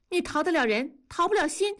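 high-pitched, emotional insistence; impassioned challenge. A narrator's reading. Woman confronting the man who backs away, impassioned. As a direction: challenge him with raw feeling.

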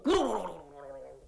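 fast low rumbling trill, fading; soft fast low rolling rumble; rolling sound. Acted. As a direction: rattle it off like a body tumbling downhill. Body rolls down slope.

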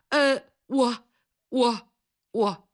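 halting, broken false starts; stammering caller who can't get it out; hesitant stammer. A narrator's reading. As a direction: stall on the same word with pauses. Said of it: "对方吞吞吐吐， 结结巴巴".

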